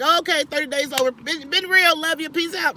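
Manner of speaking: quick, loud, high breezy brush-off; mock breezy goodbye. Vlogger mocking fair-weather followers, a fast breezy brush-off. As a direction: toss it off fast like a casual quitter. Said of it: "I'm not gonna be like".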